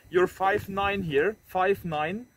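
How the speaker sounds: ordinary talk, nothing marked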